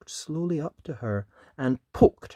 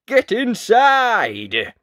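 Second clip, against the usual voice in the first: slow, loud, higher shout; hollered warning; 10 semitones higher, 11 dB louder, much slower than usual. Acted. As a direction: bellow it like knights raising the alarm. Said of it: "the knights would holler".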